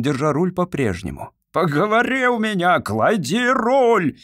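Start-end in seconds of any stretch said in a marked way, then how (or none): 1.5-4.2 high, loud, slow furious roar; furious yell. A narrator's reading. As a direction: roar the order in rage. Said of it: "яростно заорал Фомич".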